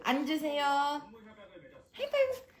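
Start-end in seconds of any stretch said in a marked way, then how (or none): 1.9-2.5 하이파이브 high, quick fall; short high command. Shown as a demonstration. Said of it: "하이파이브 repeated command".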